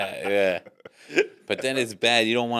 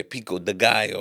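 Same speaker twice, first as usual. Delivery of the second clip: put-on Spanish accent. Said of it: "then they'll say".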